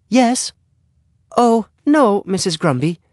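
very high-pitched mother's voice; mother on the phone. A narrator's reading. Mother takes the neighbor's phone call, in high-pitched voice. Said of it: "heard his mother say to the next-door neighbor".

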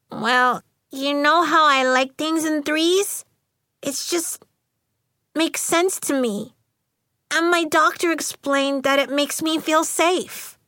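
put-on high, careful little-girl voice; small shy girl. A narrator's reading. Jocelyn telling friends about her school, small-voiced.

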